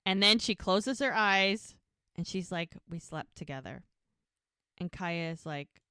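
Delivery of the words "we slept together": low, soft retold confession; quiet confession. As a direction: drop low and soft, as if admitting it. Retold reluctant confession with eyes closed.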